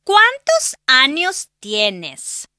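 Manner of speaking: put-on high girlish voice, each word drawn out slowly. Susana repeating the question for Ana, very slow. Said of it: "repite Susana muy despacio".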